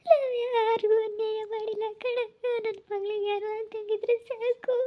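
high, wailing plea; crying lament, pitched high; tearful pleading. Acted. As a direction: sob it out as a desperate parent. Mother crying for missing daughter.

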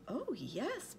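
high, swooping tiny voice; eager little speck, pitched high; piping little voice. A narrator's reading. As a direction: pipe up as a very small creature. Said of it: "piped the voice".

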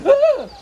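sudden high loud scream. Acted. Bee startled by a stranger, screaming. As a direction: yelp in fright.

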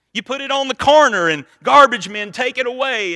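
loud, high, driving the point home; emphatic preaching. Real, not acted. Preacher to congregation, loud and emphatic.

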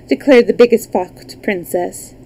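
ordinary delivery, nothing marked

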